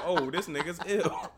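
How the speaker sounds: slow, laughing through the words; laughing quote. Retold or imitated. Retelling his reaction, slow laughing delivery. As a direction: chuckle it out slowly. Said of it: "I'm like".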